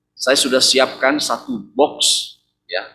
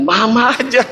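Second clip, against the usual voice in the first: higher, laughing dodge; laughing refusal; 8 semitones higher, 3 dB louder than usual. Retold or imitated. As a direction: push it back on her with a laugh. Couple passing off the job.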